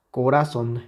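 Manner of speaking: "corazón" stressed on "ra", weak final "zon"; sin fuerza en la última. Shown as a demonstration. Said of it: "si quitamos la intensidad a la última sílaba". ("corazón" con el golpe en "ra", final débil)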